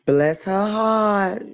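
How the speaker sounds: "Bless her heart" drawn out very slowly, each word lingered on; slow sweet dismissal. Shown as a demonstration. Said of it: "like I do".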